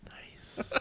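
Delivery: breathy unvoiced whisper. Real, not acted. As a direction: whisper it to yourself. Whispered reaction.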